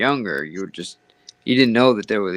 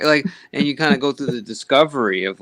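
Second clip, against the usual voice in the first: usual speaking voice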